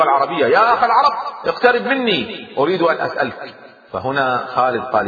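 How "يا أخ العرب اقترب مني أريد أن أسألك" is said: high-pitched hail to an opponent; raised, carrying call. A narrator's reading. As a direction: call across the field, drawing words out. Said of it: "وبدأ ينادي باللغة".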